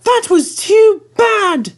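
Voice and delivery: very high, slow, loud shout; slow squeaky protest; grumbling coaches shout. A narrator's reading. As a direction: carriages yelling their complaint. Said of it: "grumbled the coaches".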